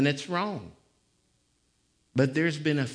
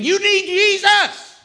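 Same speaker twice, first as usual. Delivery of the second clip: very high, loud, drawn-out "You need Jesus"; climactic shout; 13 dB louder, slower than usual. Real, not acted. Preacher to the educated, a long shouted climax. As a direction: hold the cry high and long.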